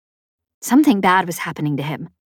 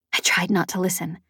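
plain reading voice

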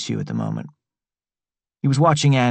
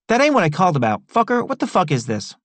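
high, fast, indignant street voice; riled-up Travis; 8 semitones higher than usual. A narrator's reading. Travis confronting Roberto about the letter, high and indignant. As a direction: demand an answer, baffled and rough. Narrator voices Tea Cake.

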